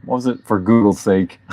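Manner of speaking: ordinary talk, nothing marked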